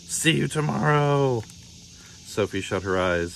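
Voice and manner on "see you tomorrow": higher, slow, teasing; sly note voice. A narrator's reading. Wendell's bedtime note taunting Sophie, slow and sing-song. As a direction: drag it out like a taunt. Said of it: "It said".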